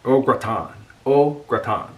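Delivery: Au gratin said oh grah-TAH, dropping the n; careful French Au gratin. Shown as a demonstration. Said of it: "That's the way you pronounce it".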